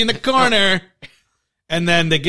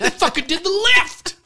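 amazed outburst; 12 semitones higher than usual. Retold or imitated. Deborah Messing's character leaps up in disbelief, amazed high cry. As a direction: blurt it out in stunned delight. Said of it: "shoots up to her feet, like".

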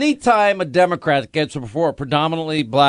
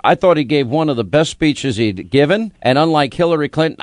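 ordinary talk, nothing marked

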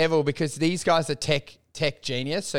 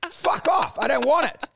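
higher, laughing defiant outburst; manic rant; 11 semitones higher than usual. Retold or imitated. Laughing mock rant about throwing money away. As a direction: fling the money away with glee.

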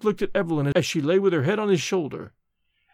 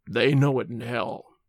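low, slow final utterance; dying man's low, slow defiance; dying last words; 5 semitones lower than usual. A narrator's reading. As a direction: let it out low and drawn out.

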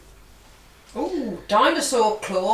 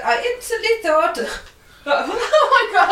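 put-on failed Welsh accent, breaking into laughter; 9 semitones higher, 3 dB louder than usual. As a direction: try a Welsh accent and crack up. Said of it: "I was trying a Welsh accent".

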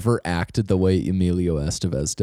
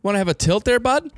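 higher, hammed-up Canadian hockey-guy accent. Teasing the Mighty Ducks fan in a hammed-up Canadian accent. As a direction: lay on the "bud" like a Canadian rink rat. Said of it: "Canadian voice".

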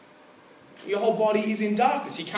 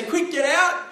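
quick, raised, higher call to flee; mock alarmed shout; 9 semitones higher, 7 dB louder, slower than usual. Pastor calling out in the dark to the congregation, raised alarm. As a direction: shout it like the lights just went out. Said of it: "I say".

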